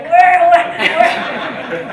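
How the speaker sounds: loud, high mock alarm; startled onlookers, imitated. Everybody around her reacting to her yelling, mock startled. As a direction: imitate people suddenly looking around, half laughing.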